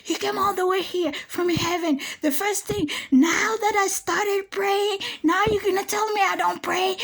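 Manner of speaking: high, indignant mock scream; retold screaming and yelling. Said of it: "I was screaming, yelling, insulting".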